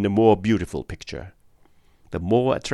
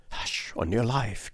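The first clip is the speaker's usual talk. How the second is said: urgent, slow, hushed warning in alarm; 2 semitones higher, 8 dB quieter, slower than usual. A narrator's reading. Miner warning a careless stranger, alarmed and hushed. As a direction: hush him fast, scared for his life. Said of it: "cried the miner".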